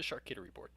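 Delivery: "charcuterie" said as "char-KITTY-rie"; cat pun pronunciation. Shown as a demonstration. Lions fan explains his snack board's pun name, "charcuterie" said as "char-kitty-rie". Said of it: "I call it the".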